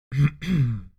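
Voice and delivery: a formal clearing of the throat before announcing. A narrator's reading. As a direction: clear your throat like a butler. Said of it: "Prim cleared his throat".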